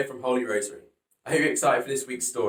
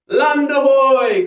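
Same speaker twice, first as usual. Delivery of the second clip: long high drawn-out call, much louder; cry out; 13 semitones higher, 11 dB louder, much slower than usual. Acted. Noah spotting the mountain, long high sailor's cry. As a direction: shout it from the lookout. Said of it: "cried Noah".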